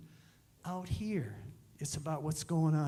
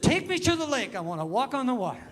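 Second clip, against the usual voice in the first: high and loud mock eager demand; eager request; 5 semitones higher, 6 dB louder than usual. Pastor retells asking to walk on the frozen lake, mock eager and loud. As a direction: play it as a brash newcomer's boast.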